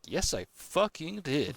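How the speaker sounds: slowed, exasperated imitation of his reply; indignant retort. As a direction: drag it out, fed up. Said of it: "I'm like".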